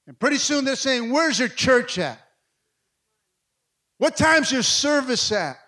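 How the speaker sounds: loud, high-pitched exhortation; full-force preaching. Real, not acted. Pastor urging church to keep going, loud impassioned preaching. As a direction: drive it home to the congregation.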